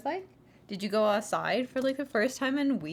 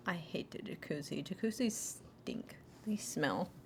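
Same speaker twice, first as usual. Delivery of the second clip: whisper; 4 semitones lower, 10 dB quieter than usual. Real, not acted. Speaker whispers disgust at jacuzzis. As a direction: whisper it like a secret.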